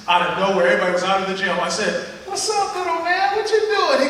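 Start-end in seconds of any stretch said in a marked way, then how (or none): 2.2-4.1 put-on lifted, bright greeting to a little kid; high friendly greeting. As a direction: greet the boy in a much higher, cheery voice.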